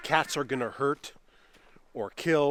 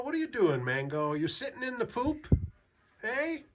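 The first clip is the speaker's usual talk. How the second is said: teasing pet talk; 9 semitones higher, 3 dB quieter than usual. Real, not acted. Teasing the cat, cooing.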